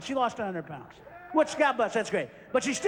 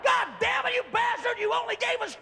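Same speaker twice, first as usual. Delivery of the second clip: screaming fans; 9 semitones higher than usual. Acted. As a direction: play a furious fan demanding the sequel. Audience voiced demanding more.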